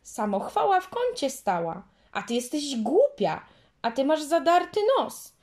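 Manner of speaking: mock her in a sing-song; taunting girl, high and loud; quarrelling taunt. A narrator's reading. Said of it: "Dziewczynki tak się kłóciły".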